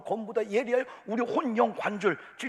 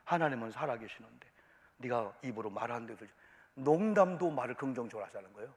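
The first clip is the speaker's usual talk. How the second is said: ordinary talk, nothing marked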